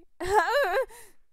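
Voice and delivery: shrill villain laugh, loud and high; high manic cackle. Acted. Captor cackling at the bound prisoner, manic laugh. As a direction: cackle over the bound man.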